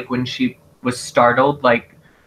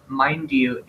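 usual speaking voice

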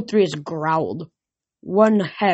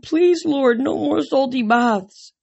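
high-pitched, drawn-out plea; wailing horses; 7 semitones higher, slower than usual. A narrator's reading. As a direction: wail it like a begging horse. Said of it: "the horses wailed".

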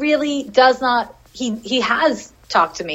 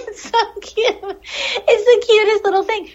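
much higher, slow squeal with laughter; cutesy gushing; 11 semitones higher, slower than usual. Real, not acted. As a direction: melt over something adorable. Gushing over the kangaroo rat.

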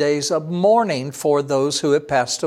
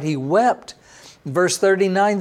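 ordinary talk

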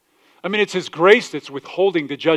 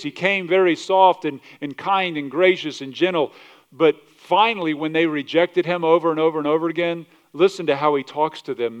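ordinary talk

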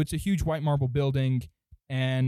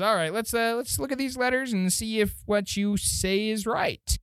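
higher-pitched gnome official voice; second gnome; 8 semitones higher than usual. Acted. Gnome official checking visitors' letters, higher voice. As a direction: pitch up and take charge of the inspection. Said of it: "he says".

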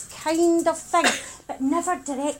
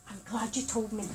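soft, low, careful reassurance; quiet calm counsellor tone; counsellor reassurance to student; 5 semitones lower, 10 dB quieter, faster than usual. Retold or imitated. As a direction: gently soothe him as trained. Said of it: "So I say".

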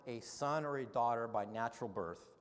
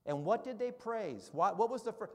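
loud, high, fervent preacher voice; impassioned sermon climax; preaching at full force; 7 semitones higher, 9 dB louder than usual. Acted. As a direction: proclaim it from the pulpit, rising to a cry.

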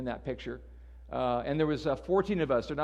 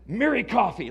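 mock indignant read; 5 semitones higher, 6 dB louder than usual. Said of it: "other Christians that get angry around Christmas time".

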